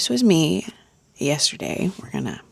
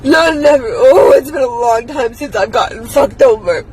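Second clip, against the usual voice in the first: higher, louder words still crying; tearful aftermath; 7 semitones higher, 5 dB louder than usual. Real, not acted. Venting to viewers after the breakdown, tearful. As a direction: push the words out between sobs.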